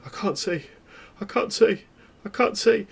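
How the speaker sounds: rising, panicked cry of a blinded pastor; a pastor gone blind, crying out in fear. Retold or imitated.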